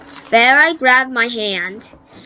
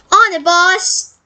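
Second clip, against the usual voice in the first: put-on Australian accent; 7 semitones higher, slower than usual. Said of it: "in his Australian accent".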